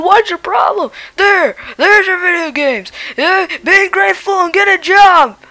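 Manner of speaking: cranky, hard-of-hearing grandpa voice; a deaf old dad barking back; grumpy old man. Acted.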